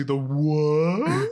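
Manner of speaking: rising, stretched-out what; exaggerated questioning surprise; mock double take. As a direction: break off into baffled disbelief. Acted double take mid-quote.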